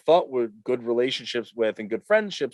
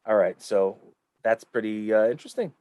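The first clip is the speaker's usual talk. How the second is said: ordinary delivery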